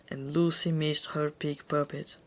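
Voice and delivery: ordinary delivery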